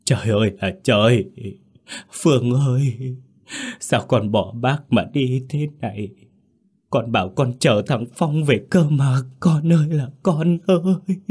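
keen over the body in grief; wailing and sobbing. Acted. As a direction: heartbroken lament. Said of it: "gào khóc thảm thiết".

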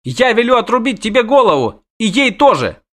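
yelling tantrum. A narrator's reading. Princess threatens witcher and dryad, high yelling tantrum. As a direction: screech threats like a spoilt princess. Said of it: "хватит вопить".

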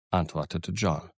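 plain reading voice

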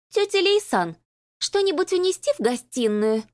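put-on high, sugary, ingratiating girl; fawning sweet voice. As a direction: fawn sweetly over auntie. Fawning cousin to aunt.

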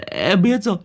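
trembling thug stammers his promise to Nam; terrified stammer. A narrator's reading. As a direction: stutter out in terror. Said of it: "run cầm cập lắp bắp".

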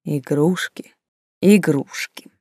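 musing, unhurried repetition; pensive, inward murmur; thoughtful. A narrator's reading. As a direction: mull the word over, half to yourself. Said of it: "задумчиво произнесла Тильда".

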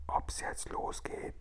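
slow, barely audible whisper; tiny whisper. Acted. Sophiechen asking Guri whether it starts now, whispering. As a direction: whisper it almost without sound. Keeps whispering into Guri's ear.

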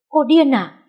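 high, loud snapped retort; impatient shout. Acted. Husband shouting back at his wife, impatient. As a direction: bark it in exasperation. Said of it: "mất kiên nhẫn quát lên".

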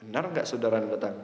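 a soft, doubting "Benar nggak saudara datang?"; doubtful question. Shown as a demonstration. A doubting listener checking the news, softly unsure. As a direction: ask it quietly, not quite believing. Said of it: "ini ragu".

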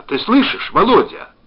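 high, loud, alarmed calling; panicked shout, pitched high; frightened cry. A narrator's reading. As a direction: call out in fright down a failing line. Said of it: "Так же испуганно, как он, закричала она".

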